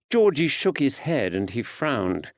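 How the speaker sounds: ordinary delivery, nothing marked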